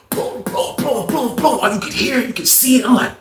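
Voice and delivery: ordinary talk, nothing marked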